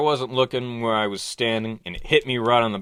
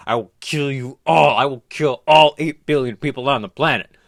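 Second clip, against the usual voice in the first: menacing killer; 4 dB louder than usual. Acted. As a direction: threaten the whole world from the tape. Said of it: "and he's going".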